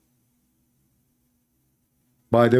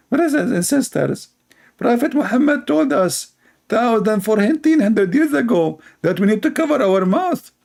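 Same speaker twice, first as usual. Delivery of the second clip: mock earnest believer; 9 semitones higher than usual.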